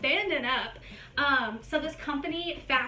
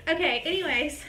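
usual speaking voice

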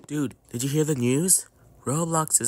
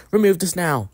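higher, louder furious demand; angry demand; 7 semitones higher, 8 dB louder than usual. Acted. Player demanding the moderator remove it, loud angry order. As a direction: bark the order.

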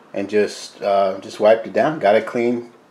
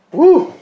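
excited whoop; 12 dB louder than usual. Real, not acted. Cheer after tasting food.

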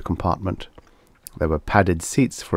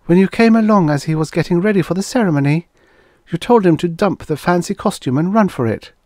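high-pitched, louder character voice; Karen's eager recounting; 10 semitones higher, 4 dB louder than usual. A narrator's reading. Woman reminding Guthrie what he told the man, eager. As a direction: pitch it high and rush the first clause.

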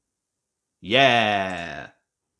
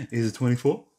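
put-on baby voice; 3 semitones higher than usual. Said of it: "I'm using my baby voice now".

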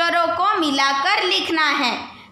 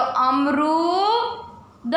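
slow reading aloud; much slower than usual. Shown as a demonstration. Teacher reading a word to young pupils, slow and drawn out. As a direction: stretch out अमरूद slowly, syllable by syllable, like a teacher. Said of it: "तो क्या हो गया पूरा?".

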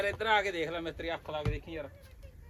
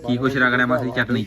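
louder, calling across to auntie; 4 semitones lower, 12 dB louder than usual. Real, not acted. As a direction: call out to her over the noise. Loud call to aunt. (ਮਾਸੀ ਨੂੰ ਉੱਚੀ ਆਵਾਜ਼ ਮਾਰਨਾ)

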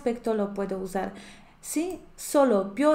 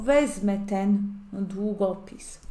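Wezmę ten długopis said slowly, each word spaced; slow model sentence; slower than usual. Shown as a demonstration. Polish teacher modelling a sentence for students, slow and clear. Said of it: "teacher example sentence".

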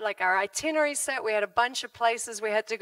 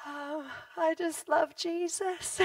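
simple sincere answer; 4 semitones higher, 6 dB quieter, slower than usual. Retold or imitated. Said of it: "And I'm like".

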